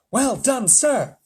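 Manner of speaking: high, loud praise; spy congratulating the president; pleased spy. A narrator's reading. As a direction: congratulate warmly.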